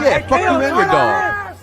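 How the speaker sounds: loud, slow jeering insult; mock angry retort. Wrestler voiced mocking his rival, loud jeer. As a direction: sneer it at him. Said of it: "He said".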